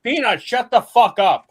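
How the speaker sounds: high, loud snap at the dog; yelling at dog. Real, not acted. [name] breaks off to yell at his barking dog Peanut. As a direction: shout the dog down.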